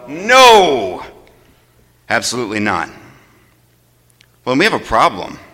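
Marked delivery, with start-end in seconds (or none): 0.1-1.0 a loud, ringing no; emphatic no. Real, not acted. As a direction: answer firmly for the church. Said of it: "said emphatically".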